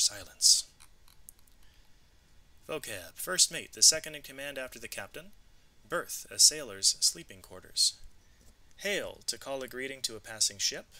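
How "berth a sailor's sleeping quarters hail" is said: ordinary delivery, nothing marked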